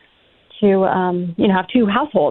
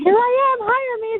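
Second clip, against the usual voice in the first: high-pitched squeal, much higher than usual; mock eager applicant; 14 semitones higher, slower than usual. Mimicking rich girls who want to be hired, high mock squeal. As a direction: pipe it up high, poking fun at the hopefuls.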